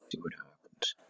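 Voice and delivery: hushed whisper to himself. Real, not acted. Clicking a scary link, whispering to himself. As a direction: breathe it out, nervous.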